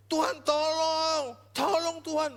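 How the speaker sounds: high, loud, slow begging; desperate plea. Shown as a demonstration. Preacher showing how the mother begged relentlessly, high and loud. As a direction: beg with everything you have. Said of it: "Bukan cuma".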